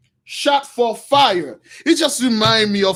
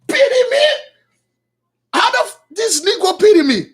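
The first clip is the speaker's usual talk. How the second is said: high, loud, incredulous outburst; indignant outrage; 11 semitones higher, 5 dB louder than usual. Acted. Saul rejecting the prophet's pity, loudly indignant. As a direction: throw it back as an outraged yell. Saul scorns being pitied.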